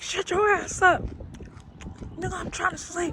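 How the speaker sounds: high, irritated telling-off; annoyed snap. Retold or imitated. Passengers snapping at the screaming man, high annoyed telling-off. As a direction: shut him up so you can sleep. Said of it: "was like".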